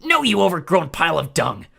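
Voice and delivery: loud, high insult; furious yell. Acted. Clarice insulting the Cyclops, loud yell. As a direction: spit the insult up at him. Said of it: "she yelled".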